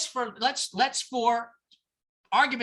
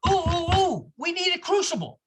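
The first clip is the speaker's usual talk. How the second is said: excited Maureen; 6 semitones higher than usual. Retold or imitated. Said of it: "suddenly Maureen says to Doug".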